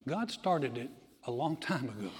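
ordinary delivery

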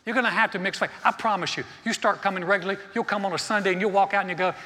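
ordinary talk, nothing marked

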